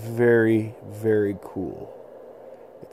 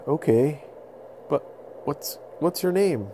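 calm the beast down, pitched up; pleading little boy; 5 semitones higher than usual. Acted. Little boy pleading with the mammoth not to charge.

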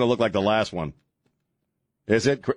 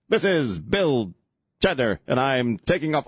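slow, halting actor's delivery with big pauses; William Shatner; 2 semitones higher than usual. Acted. Host spoofs Shatner's slow space report, William Shatner impression. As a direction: draw out each phrase, pausing mid-sentence. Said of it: "takes Shatner such a long time to say anything".

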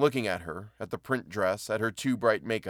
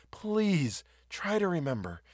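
high, slow, pleading voice; imploring woman, pitched well up; begging; 8 semitones higher, 3 dB quieter, slower than usual. A narrator's reading. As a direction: beg with a long drawn-out please. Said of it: "she begged".

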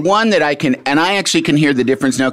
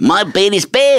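higher, playful Kiwi "My bed is bed"; light Kiwi voice; 7 semitones higher than usual. Pretend. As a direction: join the bit in a Kiwi voice. Joining the Kiwi bit.

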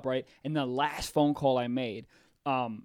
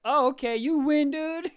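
mock scared surrender; 10 semitones higher, 3 dB louder, slower than usual.

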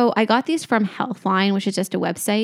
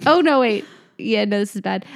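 usual speaking voice

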